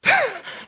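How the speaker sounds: high sudden laugh, falling off; a real laugh breaking out; burst of laughter. Real, not acted. Laughs recalling his own repentance.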